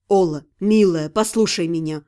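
reach her through her panic; calming. Acted. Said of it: "Успокаивающий голос Ирги".

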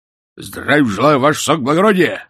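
громкий рявк по-уставному, выше и громче; отрапортуй, как старый служака; рявкнул. A narrator's reading. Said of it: "рявкнул он". (громкий рапорт городового)

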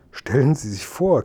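ordinary delivery, nothing marked

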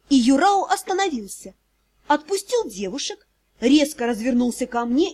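plain reading voice

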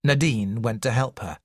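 plain reading voice

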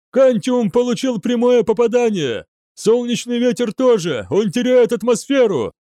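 high, strained officer's yell; shouted alarm. A narrator's reading. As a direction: shout the report across the bridge. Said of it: "прокричал один из офицеров". (кричать в боевой тревоге)

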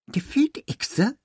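can't believe his ears; incredulous. A narrator's reading. Victim disbelieving.

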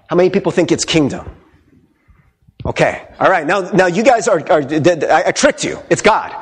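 ordinary talk

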